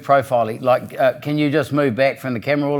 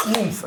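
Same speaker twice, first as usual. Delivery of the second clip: woof sound; 10 semitones higher than usual. Retold or imitated. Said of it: "just went".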